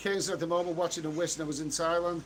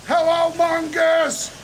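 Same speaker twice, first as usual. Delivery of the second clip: big loud high hello to the chat; rowdy shout-out to viewers; shouted greeting; 10 semitones higher, 11 dB louder, much slower than usual. Acted. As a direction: belt it out like a stage welcome. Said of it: "shout out to all the mongers in the chat".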